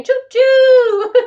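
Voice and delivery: high, loud chugging train call, played for fun; train whistle choo choo. Joking that she's full on the coffee train, loud playful choo choo. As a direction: toot it out like a toy train whistle.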